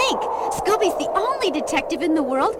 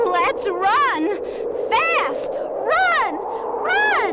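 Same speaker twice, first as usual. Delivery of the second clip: panicked shout; 5 semitones higher, much slower than usual. Acted. Fleeing the polar bear, panicked shouting at friends. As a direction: scream at everyone to flee.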